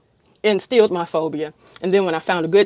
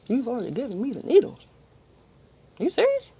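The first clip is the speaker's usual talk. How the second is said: incredulous; 5 semitones higher, 6 dB quieter, faster than usual. Retold or imitated. Patient shocked needles are done.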